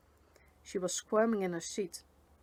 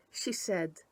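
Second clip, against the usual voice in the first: ordinary delivery, nothing marked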